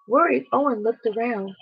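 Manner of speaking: plain reading voice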